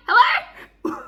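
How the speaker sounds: high, loud, indignant call; indignant hello. Retold or imitated. Reader scolding the book over a missing proposal. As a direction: demand an answer, outraged. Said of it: "I'm like".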